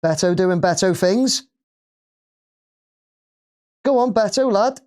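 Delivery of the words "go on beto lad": high, eager cheer for the striker. Real, not acted. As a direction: urge him on like a fan.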